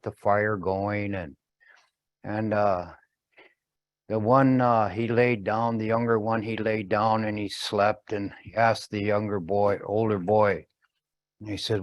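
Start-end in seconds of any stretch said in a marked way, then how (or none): none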